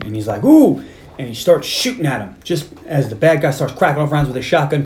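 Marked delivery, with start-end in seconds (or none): 0.4-0.8 high, loud mock whoop; retold yell. Bad guy spotting Cobra, high retold yell. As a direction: imitate a gunman's high yelp. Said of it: "'Cause he's yelling".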